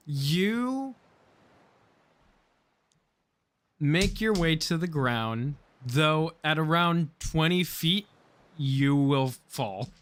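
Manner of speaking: slow, deliberate scene setting; game master narration. A narrator's reading. Game master tells player of a fall, slow narration. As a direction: draw out the narration, landing each phrase slowly.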